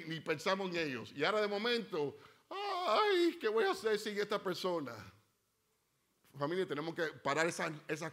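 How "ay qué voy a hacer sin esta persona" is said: mock wail. Mocking grieving Christians.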